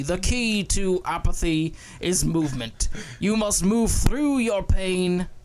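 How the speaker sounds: slow, drawn-out bad British accent; weird British accent. Shown as a demonstration. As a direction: attempt a stagey British accent, stretching the vowels. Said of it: "shooting for weird British".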